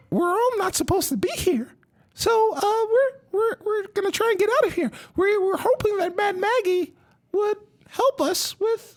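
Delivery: very high, fast cheerful little voice; bubbly and eager, pleading for help; Lulu's voice. Acted. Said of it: "and she says".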